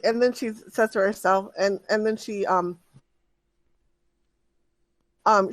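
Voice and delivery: normal talking voice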